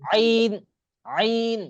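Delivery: teacher modelling the Arabic ain; throaty ain. Shown as a demonstration. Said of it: "ভিতর থেকে".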